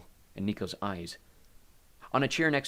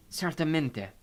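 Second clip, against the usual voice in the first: put-on higher Italian-accented voice; 6 semitones higher, slower than usual. A narrator's reading. Maria answers Hades, in an Italian accent. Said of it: "she spoke with an Italian accent".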